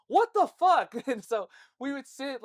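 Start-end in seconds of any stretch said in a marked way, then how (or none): 0.1-0.9 high, loud burst of exasperation; outraged mock yell. Boy waiting on unready friend, outraged yell. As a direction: explode at him for comedy.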